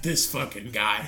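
put-on low drunken aside about a stranger; imitated drunk friend. Drunk Cody to his friend about the slot player, low and dismissive. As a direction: drop into a low impression of a drunk buddy.

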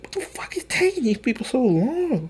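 high-pitched exasperated complaint; irritated. Retold or imitated. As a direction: imitate an impatient friend griping. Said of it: "he was so irritated".

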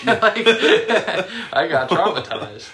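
high, laughing through it. Real, not acted. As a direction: laugh out the words. Laughing at own trauma.

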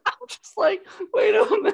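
very high, laughing delivery; laughing disbelief. Real, not acted. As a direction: squeal it through real laughter. Laughing reaction to retold joke.

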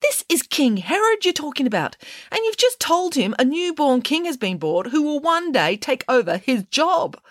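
high-pitched, alarmed rebuke; indignant scolding. A narrator's reading. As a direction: scold him up high, appalled. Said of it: "accused Judith Piggins as she leapt".

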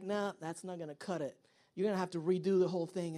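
low, soft, offhand dismissal; lightly voiced boss brushing the work off; supervisor. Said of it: "You show it to your supervisor".

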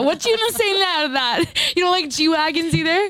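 playful high gotcha taunt; teasing challenge. Real, not acted. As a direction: tease her, you've cornered her. Teasing friend, put in a corner.

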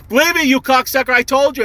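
high-pitched mock yell at a friend; hammed-up high complaint; retold angry yell. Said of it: "yelling at each other".

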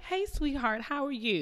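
high, sugary fake-nice greeting; fake sweet. Mom in the store covering a pinch, high and sugary. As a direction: sound sweet while hiding the pinch.

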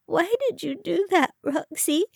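high, tearful whimper. A narrator's reading. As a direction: ask it plaintively through tears. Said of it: "Iris whimpered softly".

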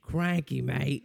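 bad Australian accent. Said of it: "my Australian accent's not that bad".

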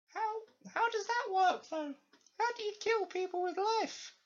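very high put-on falsetto; squeaky baffled underling. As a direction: question it in a silly high voice. Underling baffled by Lysander's answer.

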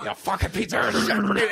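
milk it like a melodramatic death scene; overdramatic cop line. Retold or imitated. Cop on the floor cursing the killer, over-dramatized again. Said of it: "way over dramatizing it".

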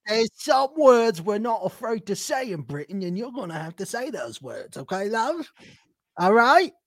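playful put-on British voice; mock British accent. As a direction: tease like a cheeky Brit.

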